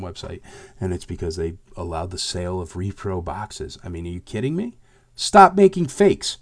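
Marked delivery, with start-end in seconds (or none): 5.1-6.4 loud angry command; shouting. Real, not acted. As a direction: yell it at the fakers. Ranting at fakers.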